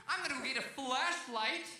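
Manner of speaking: high-pitched mock holler; yelled announcement. Owner informing his dog of the plan, mock yelling. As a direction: call it out to a faraway dog. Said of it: "So I yell at my dead dog".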